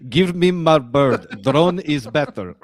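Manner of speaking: slow, loud mock-Russian accent; Mickey Rourke's Whiplash accent. Host mimicking a film villain's terrible accent, slow hammed-up Russian. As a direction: ham up a bad movie Russian accent, word by word. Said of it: "what's his name's accent?".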